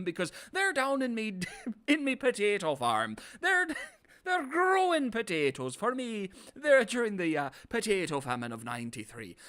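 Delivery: hammed-up stage-Irish accent with high squeaky jumps; silly Irish leprechaun brogue, to be sure; mock Irish accent. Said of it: "and then Fowler's like".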